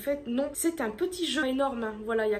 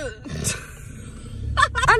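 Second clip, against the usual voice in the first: embarrassed laugh; 3 semitones higher, 6 dB louder than usual. Real, not acted. Laughing at her haircut.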